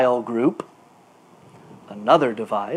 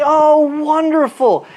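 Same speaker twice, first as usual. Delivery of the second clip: much higher, slow, sugary mock delight; 9 semitones higher, 4 dB louder, slower than usual. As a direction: coo it like a pleased hostess, hammed up. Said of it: "He doesn't say".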